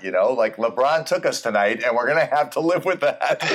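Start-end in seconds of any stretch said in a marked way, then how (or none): 1.8-3.5 says it through a laugh; chuckling while he talks. Real, not acted. Joking about LeBron loss.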